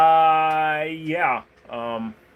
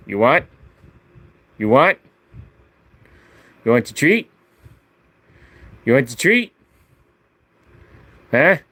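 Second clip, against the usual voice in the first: teasing a treat; 5 dB louder than usual. Real, not acted. Offering the dog a treat.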